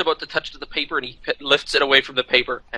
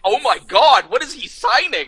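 higher-pitched, aghast reaction; mock shock; 10 semitones higher than usual. Con staff gaping at the picture, mock shocked. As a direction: imitate dumbfounded onlookers, pitch climbing. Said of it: "mouth wide open like".

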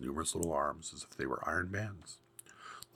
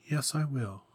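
trembling voice; 8 semitones higher, 3 dB louder than usual. A narrator's reading. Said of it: "in a trembling voice".

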